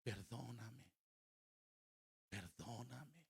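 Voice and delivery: soft, hushed plea for forgiveness; pleading prayer. Retold or imitated. Pastor asking the Lord for forgiveness, hushed and pleading. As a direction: drop the voice and beg humbly, with pauses. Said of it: "le dije, "Señor, perdóname".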